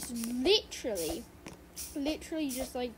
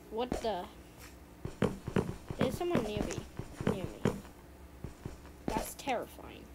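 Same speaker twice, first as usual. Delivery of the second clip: usual speaking voice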